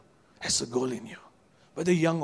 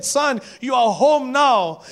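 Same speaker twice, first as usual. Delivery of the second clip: slow, loud call, pitch up; loud and slow as the father; father's loving call; 9 semitones higher, 9 dB louder, much slower than usual. Acted. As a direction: call the son home with open arms. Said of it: "the father interrupted him and said".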